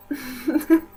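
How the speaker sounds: a quick embarrassed giggle; short laugh. Real, not acted. Vlogger laughing at her own remark to viewers, light giggle. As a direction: laugh off the awkward line.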